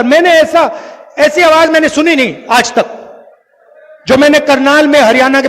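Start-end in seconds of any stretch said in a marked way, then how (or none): none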